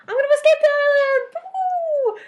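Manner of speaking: hammed-up triumphant whoop; mock gleeful escape. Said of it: "he was like".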